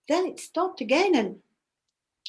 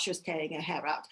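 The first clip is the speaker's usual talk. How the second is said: plain reading voice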